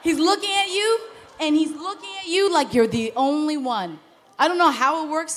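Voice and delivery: loud, driving sermon climax; fiery preaching. Acted. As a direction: preach it hard to the congregation. Preaching at full force.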